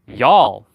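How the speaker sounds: loud "y'all" run into one syllable; insistent y'all. Retold or imitated. Steve purposely repeats it to the teacher, insistent "y'all". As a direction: push "y'all" out loudly as one word. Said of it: "he kept saying".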